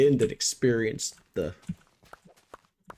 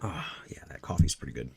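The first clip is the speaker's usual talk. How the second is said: whispered aside; 5 semitones lower than usual. Real, not acted. Sipping coffee, aside to self.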